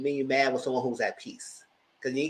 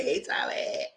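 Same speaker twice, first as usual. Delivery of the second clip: sultry whisper; 12 semitones lower, 6 dB quieter, slower than usual. Retold or imitated. Her habitual flirty greeting.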